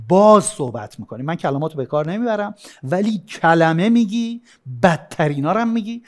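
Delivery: usual speaking voice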